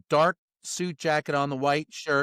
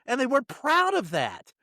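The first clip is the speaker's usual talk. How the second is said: normal talking voice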